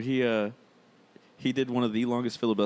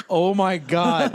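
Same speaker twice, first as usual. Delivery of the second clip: slow, drawn out, higher and louder through laughter; laughing disbelief; 8 semitones higher, 7 dB louder, much slower than usual. Retold or imitated. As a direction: stretch it out while cracking up. Retelling his reaction to coworker.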